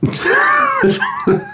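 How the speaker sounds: a sudden high shriek of laughter. Real, not acted. Cohost laughs at the superglue comparison, shrieking. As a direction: burst out in a squealing laugh at the joke.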